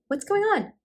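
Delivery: high-pitched acted question. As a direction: ask her, voice leaping high. Daughter questions struggling mother.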